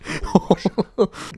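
high bursting laugh. Real, not acted. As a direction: let a big laugh burst out. Laughs at the game.

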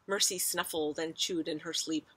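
plain reading voice

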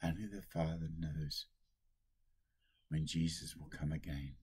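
normal talking voice

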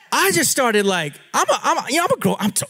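ordinary delivery

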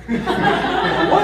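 a sudden squeaky high "What?" with a laugh; shocked what. Retold or imitated. Father stunned by son's news while driving, squeaking in disbelief. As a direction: caught completely off guard, half laughing.

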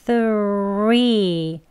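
three with the tongue between the teeth, drawn out; slow three demo. Shown as a demonstration. Teacher slowly modelling three for students. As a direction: push air gently through three. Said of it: "공기로 편하게 밀면서".

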